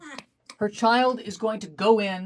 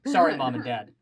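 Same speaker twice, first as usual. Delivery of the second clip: ordinary talk, nothing marked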